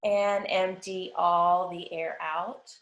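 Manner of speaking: even, unhurried body-scan voice, long pauses; guided meditation. Acted. Yoga teacher guiding the class through a body scan, guided meditation. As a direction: lead them through the body phrase by phrase, leaving space to breathe.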